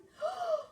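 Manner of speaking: high startled gasp; sharp gasp. A narrator's reading. Cat falls into water.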